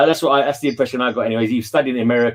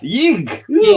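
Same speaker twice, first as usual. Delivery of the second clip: exclamation of disgust; 12 semitones higher, 4 dB louder than usual. Shown as a demonstration. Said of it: "sounds like an exclamation of disgust".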